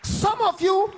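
single them out, pitched high; forceful call-out. Real, not acted. Preacher singling out some of the congregation, forceful call-out.

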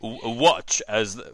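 slow, halting nature-documentary narrator, lightly attempted; half-hearted go at a British documentary voice; David Attenborough. Co-host tries a pinball call-out in his voice, tentative impression. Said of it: "in his voice".

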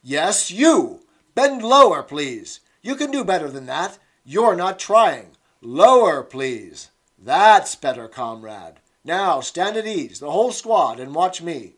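loud, high, hectoring commands; scolding instructress. Acted. Instructress scolding Winston through the telescreen, loud and hectoring. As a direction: berate him in front of the squad.

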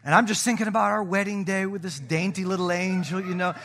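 ordinary talk

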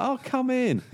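high-pitched, gushing invitation; hammed-up delighted host; effusive welcome. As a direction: usher in a dear old friend. Star welcomes friend inside.

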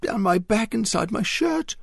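put-on a boy with a spider down his back, barely holding it together; terrified croak. A narrator's reading. Arthur telling Mary about the spider, terrified. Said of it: "croaked Arthur, terror constricting his voice".

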